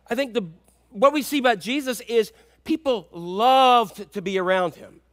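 ordinary talk, nothing marked

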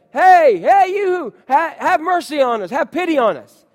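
high, loud desperate call; yelling for mercy. Retold or imitated. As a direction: call out from far away begging. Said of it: "they yell to him".

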